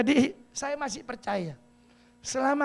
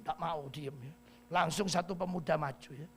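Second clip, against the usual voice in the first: ordinary delivery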